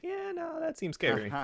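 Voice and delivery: high-pitched nervous quote; squeaky scared voice. Retold or imitated. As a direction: jumps up high on the yeah. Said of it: "and he's like".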